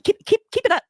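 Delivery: high-pitched, insistent taunt; goading dare. Real, not acted. Streamer daring Brittany to keep reacting, goading. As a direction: egg her on in a high voice, repeating the first word.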